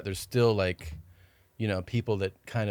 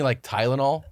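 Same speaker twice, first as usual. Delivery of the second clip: usual speaking voice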